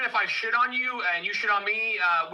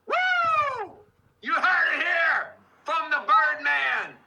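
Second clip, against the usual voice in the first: hype shout; 8 semitones higher, much slower than usual. Real, not acted. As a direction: whoop it up like a ring announcer. Hyping the crowd on stream.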